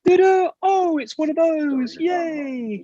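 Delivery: high-pitched mock-excited presenter; toy unboxing video. Mocking kids' toy-unboxing videos, high fake excitement.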